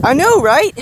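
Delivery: high, loud gleeful agreement; giggly delight. A narrator's reading. Woman agreeing with surprised Hiro, giggly delight. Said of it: "The woman giggled".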